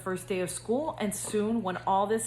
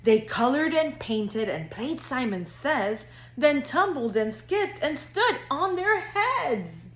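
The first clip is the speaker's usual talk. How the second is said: lively singsong storybook reading; reading to children; 3 semitones higher than usual. A narrator's reading. Counselor reading to future kindergartners, bouncy storybook narration. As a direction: read it like bedtime story time for little kids. Reads picture book to kindergartners.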